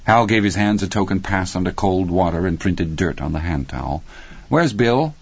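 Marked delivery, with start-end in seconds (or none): 4.5-5.1 put-on child voice. Child Hal, pitched up.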